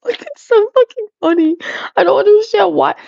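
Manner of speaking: high giggly disbelief; laughing. Real, not acted. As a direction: laugh through the words. Laughing at chat.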